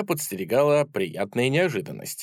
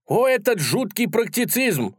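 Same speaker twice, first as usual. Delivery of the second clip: high, theatrical exclamation; outraged cry; 10 semitones higher than usual. A narrator's reading. Idealist grabs his sword at the sceptic, outraged cry. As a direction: cry out in mock-heroic outrage. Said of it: "закричал Так".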